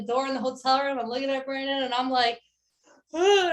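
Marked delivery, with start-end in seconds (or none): 3.1-3.5 a high, loud ugh breaking into tears; imitated crying groan. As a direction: let out a sobbing ugh. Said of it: "I start crying".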